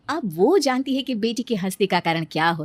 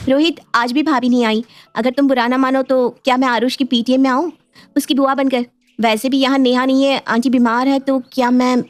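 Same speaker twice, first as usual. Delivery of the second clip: higher-pitched, hopeful request; a touch higher, coaxing sister-in-law; lightly voiced Kajal; 5 semitones higher than usual. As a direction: ask tentatively for a favour, in a higher voice.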